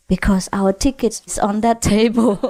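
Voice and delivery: ordinary delivery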